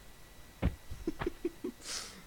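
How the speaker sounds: breathy, wheezing laugh. Real, not acted. Streamer amused by own accents, laughing. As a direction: crack up helplessly at your own joke.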